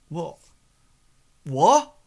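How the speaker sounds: rising, then loud baffled double take; confused. Acted. Thug baffled by the advice, second word loud and rising.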